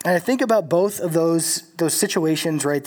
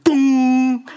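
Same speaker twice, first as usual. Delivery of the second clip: a loud ringing ding, pitched up; mock metal gong clang; gong imitation; 7 semitones higher, 6 dB louder than usual. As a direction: ring it out like a struck gong. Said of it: "it sounds like a gong".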